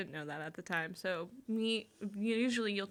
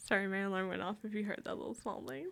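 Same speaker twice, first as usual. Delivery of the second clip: ordinary talk, nothing marked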